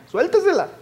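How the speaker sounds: lanza la palabra muy agudo y algo más fuerte; remátalo con un grito agudo de entusiasmo; exclamación aguda. Acted. Exhorta con énfasis. (suéltesela con un grito agudo y eufórico)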